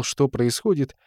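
usual speaking voice

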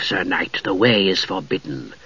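low, soft hollow spectral voice; ghostly spectre voice. A narrator's reading. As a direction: speak like a cold ghost. Spectre guardian speaking.

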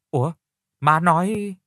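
a jolt of alarm on ủa. A narrator's reading. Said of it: "Phụng thì hoảng hốt".

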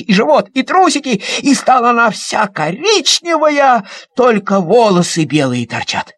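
ordinary delivery, nothing marked

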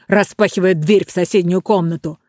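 plain reading voice

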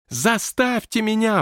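high, drawn-out defiant yell; defiant growl. A narrator's reading. Ghost answering May's order to shut up, growling defiantly. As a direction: throw it back as a growling challenge. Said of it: "прорычал в ответ Уоллес".

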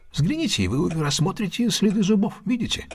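second "Взгляните," leapt up high, urging; eager high "Взгляните,"; insistent repeat. Acted. Holmes repeats, pressing Watson to look.

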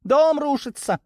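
high-pitched cry of alarm; alarmed shout. A narrator's reading. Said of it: "крикнул кто-то из бойцов". (тревожный крик высоким голосом)

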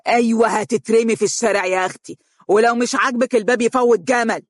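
mocking furious husband; screaming. A narrator's reading. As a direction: shout her out the door. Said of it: "وهو يصرخ فيها".

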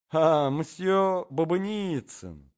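slow, high, drawn-out coo of greeting; chirping. A narrator's reading. Lady greets the tall gentleman, chirping. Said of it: "защебетала дама".

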